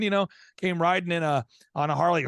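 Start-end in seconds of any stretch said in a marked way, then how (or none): none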